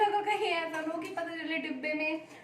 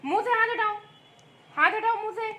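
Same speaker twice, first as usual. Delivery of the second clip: mock scolding; 7 semitones higher, 5 dB louder than usual. Real, not acted. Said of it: "मैं डांट रही हूँ ना".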